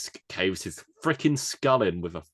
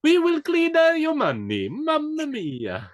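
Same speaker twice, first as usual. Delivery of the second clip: much higher, louder hammy Italian voice; slow, exaggerated Italian mobster; jolly italian pizza chef; 8 dB louder, slower than usual. Copying the mobster bit.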